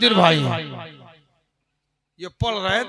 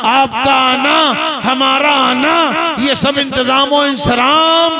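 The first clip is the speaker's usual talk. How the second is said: ordinary talk, nothing marked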